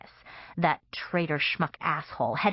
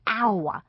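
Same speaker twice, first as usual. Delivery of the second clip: pained cry; 4 semitones higher than usual. Acted. Pain cry after stab.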